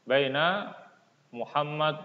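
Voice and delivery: ordinary talk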